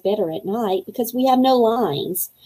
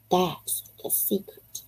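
whisper; 2 semitones lower, 11 dB quieter, slower than usual. Acted. Secret confided in diary.

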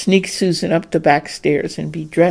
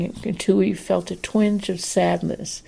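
ordinary delivery, nothing marked

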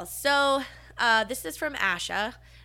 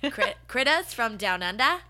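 tentative, questioning Aussie accent on "critters from Down Under"; botched Australian accent; 3 semitones higher, 4 dB louder than usual. Shown as a demonstration. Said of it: "how would you say that in an Australian?".

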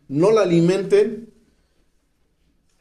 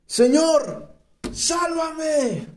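high, pleading cry for rescue; desperate cry; 10 semitones higher, much slower than usual. A narrator's reading. Peter sinking, crying out to the Lord in desperation. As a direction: cry out like a sinking man begging to be saved. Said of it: "Entonces gritó".

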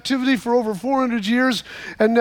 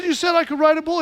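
higher-pitched, insistent reminder; boyish protest; 6 semitones higher, faster than usual. Retold or imitated. Boy holding grandpa to his word, protesting. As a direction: plead your case as a let-down kid.

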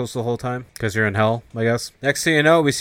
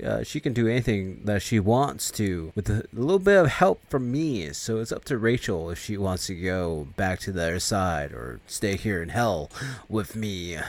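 menacing devil; 4 dB quieter than usual. Retold or imitated. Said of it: "He's like".

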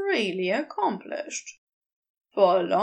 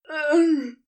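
dismayed cry; 4 semitones higher, 5 dB louder than usual. Acted. Crowley sees stone smashed.

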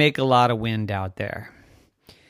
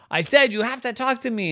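raised-pitch, hurried insistence; insistent demand; 11 semitones higher, faster than usual. Acted. Harry repeating himself to townspeople, insistent. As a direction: repeat it as a demand to someone who can't hear.

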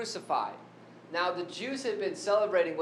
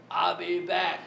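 mock action movie catchphrase; movie tough-guy impression; 4 dB louder, slower than usual. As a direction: growl it like a movie cyborg. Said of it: "he didn't quite say it like".

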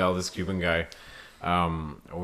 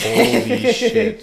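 slow, high drawn-out holy shit; mock shock; 12 semitones higher, much slower than usual. Glasses fell in the toilet, slow high mock shock. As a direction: stretch it out in mock horror.

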